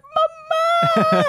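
very high, stretched mock child's wail; hammed-up little girl calling out from far away; little girl's cry. Little girl atop the escalator to fallen mother, high mock wail. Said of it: "the little girl is up at the top like".

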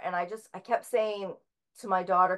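ordinary delivery

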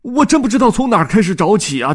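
high, loud, desperate. Acted. Dodds despairing about where to search, high and loud. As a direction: at his wits' end. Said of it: "多兹急得简直要疯了".

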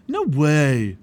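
loud, emphatic refusal; stubborn refusal. A narrator's reading. Obstinate refuses.

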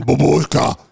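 babushka in a hammed-up Russian accent; mock Russian accent. Mocking fake movie Russian accent. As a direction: parody a bad movie Russian.

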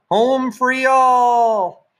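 high, loud, drawn-out victory yell; a kid's triumphant long yell of home free; yelled call. A narrator's reading. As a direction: shout it out as you reach base. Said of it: "made a mad dash for the hall bathroom and yelled".

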